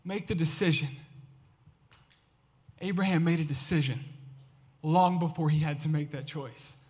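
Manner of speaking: low, soft and earnest; hushed sincere altar-call voice; soft appeal. Acted. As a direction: lean in quietly, pleading gently.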